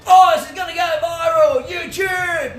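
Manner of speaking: high, loud mock shout; excited phone-filming onlooker. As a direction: whoop like a gawker filming for views. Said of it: "Don't be there with your phone like".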